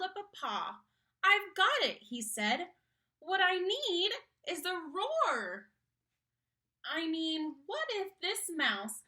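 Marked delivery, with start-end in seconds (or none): 1.2-2.0 high, loud burst of excitement; excited discovery. Acted. Mouse's excited idea. 3.2-9.0 lightly voiced mouse, a bit higher; a hopeful small mouse dreaming big; eager little mouse. Mouse voice figure.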